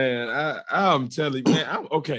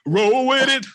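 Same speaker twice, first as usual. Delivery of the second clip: excited; 11 semitones higher, 7 dB louder, slower than usual. Pretend. Echoing cohost playfully.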